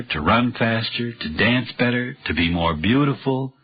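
ordinary talk, nothing marked

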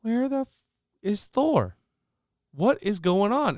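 high, baffled questioning; searching for a missing teammate, rising alarm; retold confusion. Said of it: "And I'm like".

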